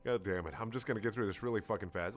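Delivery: ordinary talk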